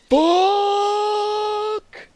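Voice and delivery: loud, high, drawn-out curse; frustrated shout. Real, not acted. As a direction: yell it out in frustration. Sudden frustrated outburst in game.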